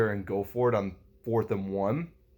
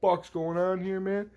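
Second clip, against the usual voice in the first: higher, half-shouted outrage at the play; retold rage; 9 semitones higher than usual. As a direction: vent like a furious fan watching it happen. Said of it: "I was fucking raging".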